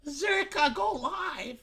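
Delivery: very high mock fan voice; begging fan imitation. Streamer mimics chat asking him to stream, begging fan imitation. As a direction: squeal it like a pleading viewer.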